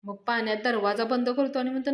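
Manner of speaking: ordinary delivery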